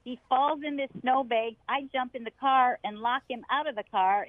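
ordinary talk

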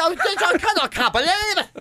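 high, mock-astonished salesman; hammed-up disbelief. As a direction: act floored by a celebrity sighting. Salesmen hammed-up amazement at customer.

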